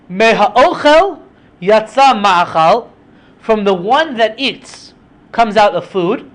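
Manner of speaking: slow, emphatic recitation; posing a riddle. Acted. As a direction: declaim each phrase slowly like a challenge.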